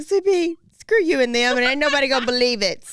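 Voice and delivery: mock-sassy sweetie comeback; sassy retort. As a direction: toss it back with sass. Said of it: "I was like".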